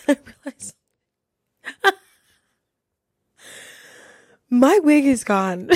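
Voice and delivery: real helpless giggles; laughing hard. Real, not acted. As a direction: burst into laughter partway through the reveal. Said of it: "laughing this hard".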